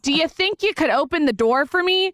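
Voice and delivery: annoying wife. Retold or imitated. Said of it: "so annoying".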